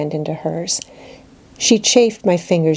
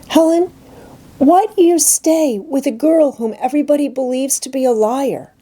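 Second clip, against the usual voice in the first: put-on higher, pleading girl's voice; a hurt schoolgirl begging to understand; anguished young girl asking her friend; young Jane, pleading; 9 semitones higher than usual. Jane speaks in dialogue.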